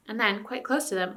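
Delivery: ordinary delivery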